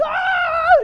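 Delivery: loud, high-pitched wail; hysterical mock scream. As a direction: burst into an over-the-top emotional scream. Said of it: "highly emotional going".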